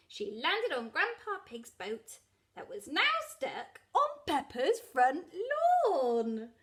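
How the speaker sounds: ordinary delivery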